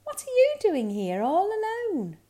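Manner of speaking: high, swooping friendly question; friendly reindeer. A narrator's reading. Reindeer finds bear cub alone, high friendly question. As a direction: ask in a high, concerned storybook voice. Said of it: "he heard a friendly voice".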